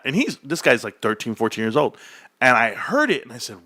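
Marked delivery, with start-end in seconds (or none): none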